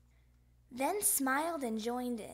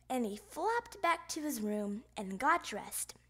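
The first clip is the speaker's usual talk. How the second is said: ordinary delivery, nothing marked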